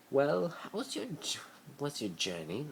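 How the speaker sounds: high, polite hostess voice; Narcissa asking. A narrator's reading. Narcissa asks Harry about journey, high sweet hostess. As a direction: ask sweetly as the gracious hostess.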